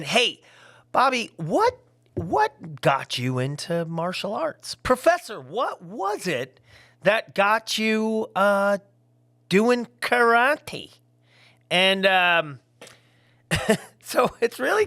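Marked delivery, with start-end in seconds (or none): 0.0-10.9 curious fan. Retold or imitated. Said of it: "you're saying".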